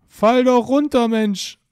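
loud, impatient urging; frustrated shout. Real, not acted. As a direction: yell at it to just fall. Urging the avatar to drop.

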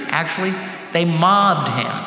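ordinary delivery

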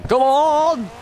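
high, loud come on; urging shout. Real, not acted. Driver yelling his stalled racing car forward, loud high shout. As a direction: yell it at the screen to push the car.

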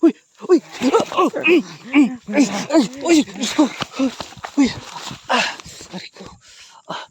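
sharp, high, loud yelps of fright; startled cries. Acted. Vlogger attacked by the aswang woman, loud frightened yelps. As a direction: cry out as she attacks you.

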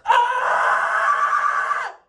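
high, drawn-out scream; pained scream. Real, not acted. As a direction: scream long and high from the needle. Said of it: "I'm screaming".

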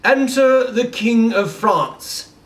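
high, slow English announcement; English herald. Retold or imitated. A servant heralds the king in an English accent. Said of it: "all the servants are the ambassadors".

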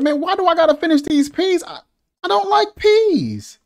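put-on high-pitched, hurried kid's protest; complaining child. Child protesting a pile of peas, high-pitched whining. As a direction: whine like a kid stuck at the table. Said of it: "The whole time you like".

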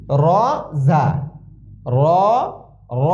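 thick, heavy Arabic letter sound; বাংলায়: মোটা, ভারী উচ্চারণ. Shown as a demonstration. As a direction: say it full and heavy from the throat. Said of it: "একটু মোটা হবে".

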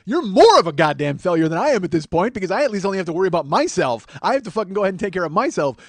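high, loud, worked-up tirade; comic indignant rant. Acted. Comic tells off a father, indignant rant. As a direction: lay into him point by point.

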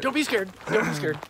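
high put-on voice, easing down; mock reassurance. Calming a startled friend on the trail, high mock reassurance. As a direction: playfully coax someone not to worry.

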